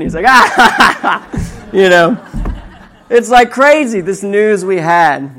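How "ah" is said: loud, higher mock belly laugh; imitated laughing. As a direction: imitate a dad cracking up. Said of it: "my dad's just laughing".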